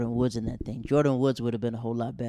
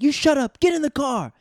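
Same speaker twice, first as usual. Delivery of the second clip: high, loud barking cop voice; cop yelling; 10 dB louder than usual. Retold or imitated. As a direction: yell it like a furious cop shoving a kid in the car. Cop yelling at arrested kid.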